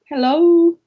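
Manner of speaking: ordinary delivery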